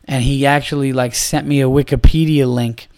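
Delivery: ordinary talk, nothing marked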